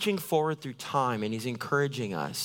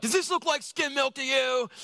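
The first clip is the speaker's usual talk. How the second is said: mock-indignant, much higher put-on voice; huffy whine at the barista, pitched way up; indignant customer; 11 semitones higher than usual. Customer complaining to the barista about skim milk, mock indignant.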